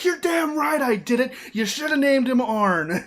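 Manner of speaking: loud, high, indignant mock rant; pissed off. Arn Anderson confessing to killing the horse, pissed off. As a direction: own up to it angrily, as an aggrieved man. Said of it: "he is all pissed off".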